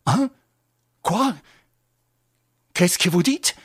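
jolted awake in fear; terrified. Acted. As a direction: woken prisoner gasps out questions in fright. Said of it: "terrifié".

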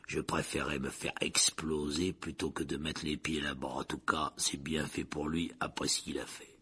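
very low, subdued grumble. A narrator's reading. Driver saying he dreads the prison, low and sombre. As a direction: keep it deep and soft. Slow driver continues his remark.

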